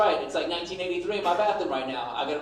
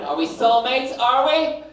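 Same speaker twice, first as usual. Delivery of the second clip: louder, higher pushy wife asking; mock wife demand; 6 semitones higher, 7 dB louder, slower than usual. Wife pressing husband about being soulmates, loud and high. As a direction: press him like a needy wife. Wife's demand voiced in bit.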